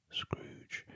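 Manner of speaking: ordinary delivery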